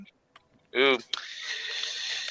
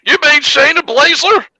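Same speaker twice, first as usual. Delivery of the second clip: high-pitched comic heckling question; mock incredulity; 11 semitones higher than usual. Mock ignorance, heckling co-host.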